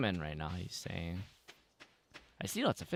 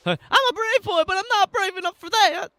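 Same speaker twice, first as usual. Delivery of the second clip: put-on high kid voice; 6 dB louder than usual.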